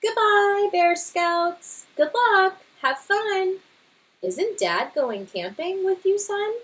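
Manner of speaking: high, sweet mother bear voice; Mama Bear. Acted. Mother bear waving off her scout cubs, high and sweet.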